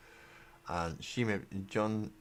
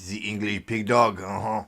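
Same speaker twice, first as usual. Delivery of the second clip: loud mock French accent; put-on French accent, louder, with a haughty chuckle; 2 semitones higher, 11 dB louder than usual. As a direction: jeer in a hammed-up French accent. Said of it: "to do a French accent".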